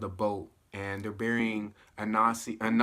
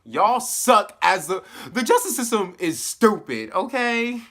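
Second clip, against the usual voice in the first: louder and higher, laughing in disbelief; exasperated outburst; 8 semitones higher, 8 dB louder than usual. Real, not acted. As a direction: call the system out with a laugh. Venting at the show's justice system.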